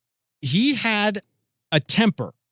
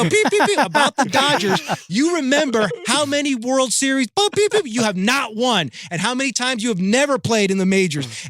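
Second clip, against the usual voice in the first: imitating a furious manager, voice pitched way up; Tommy Lasorda rant; 7 semitones higher than usual. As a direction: spit the bleeped tirade high and fast like an old baseball boss. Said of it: "he's spitting anger".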